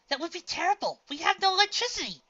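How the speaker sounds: high, loud dismayed whine; loud, high whining dismay. A narrator's reading. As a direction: wail it like a spoiled filly. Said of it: "whined in dismay".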